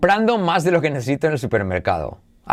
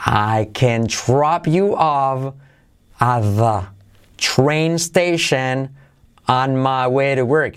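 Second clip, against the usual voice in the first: slow, drawn-out teaching pronunciation; slow model sentence; slower than usual. Shown as a demonstration. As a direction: spell it out slowly, phrase by phrase, for learners. (frase modelo, dicha despacio y por partes)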